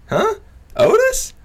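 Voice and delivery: mock shocked girlfriend; surprised. Girlfriend asking who is trying to fight him, surprised.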